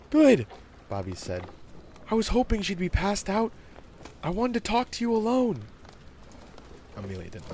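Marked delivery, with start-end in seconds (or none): 0.1-0.5 put-on high, loud kid voice; eager young boy. Boy answers girl eagerly. 2.1-5.6 put-on high-pitched, louder kid voice; keen child with news to share; eager young boy.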